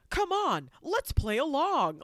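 high, loud, slow showy enthusiasm; hammed-up breezy musical cue; mock cheery invite. Singer to the band, hammed-up cheer. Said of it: "approach any musician and go".

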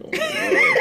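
high laughing panic, imitated; freaking out. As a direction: squeal it through a nervous laugh. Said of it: "And I'm, like, freaking out".

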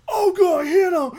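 high, loud cry to hit him; imitating a bloodthirsty driver; mock shout. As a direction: egg on the driver with a yell. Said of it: "they're like".

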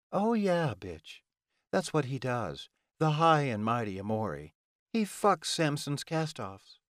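high-pitched jeering villainess; scornful Ilona. Acted. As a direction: expose him with mocking relish. Ilona sneers about Amori.

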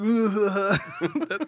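mock villain cackle; evil laugh. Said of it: "that's my best evil laugh".

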